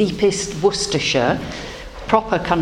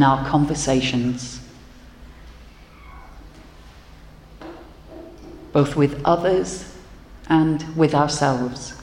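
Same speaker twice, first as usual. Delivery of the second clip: leading prayer. Acted. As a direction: lead the congregation gently into thanks. Leads congregational prayer.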